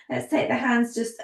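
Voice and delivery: usual speaking voice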